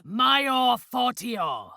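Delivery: slow, drawn-out Latin spell chant; slow ritual incantation. Acted. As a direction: intone each word slowly like casting magic.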